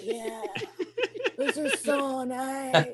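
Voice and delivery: soft, slow, drawn-out murmur; mock a creepy lover, very quietly; hushed mock-creepy. Guy petting her eyebrows while she sleeps, hushed and creepy.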